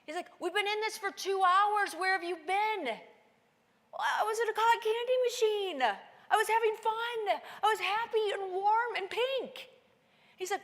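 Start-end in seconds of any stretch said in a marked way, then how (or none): 0.4-2.8 higher and louder disbelief; mock the clueless one; incredulous teammate. Teammate telling the climber they've been in the storm, incredulous. 2.8-9.7 much higher, louder sing-song innocence; giddy excuse. Retold or imitated. Climber explaining her cotton candy daydream, giddy and innocent. Defending her happy bubble.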